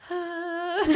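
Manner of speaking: mock calming sigh. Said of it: "I'm like".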